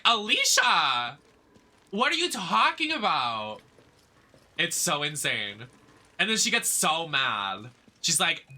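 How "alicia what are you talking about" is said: higher, louder, stretched-out Alicia; mock disbelief. Fan retelling her own reaction to the show, loud mock disbelief. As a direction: drag out the name in mock outrage. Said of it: "I was like".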